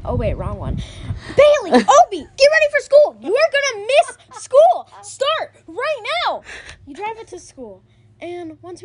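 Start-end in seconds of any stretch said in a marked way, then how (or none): 1.4-6.4 put-on loud, high, urgent mom hurrying kids; bossy mom yelling. Mom hurrying her kids to school, loud and high. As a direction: nag them out the door. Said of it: "it starts when you say".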